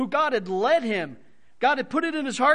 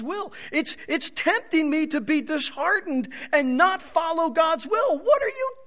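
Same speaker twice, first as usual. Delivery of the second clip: anguished plea; 6 semitones higher, 4 dB louder than usual. Acted. Paul's anguished plea in sermon.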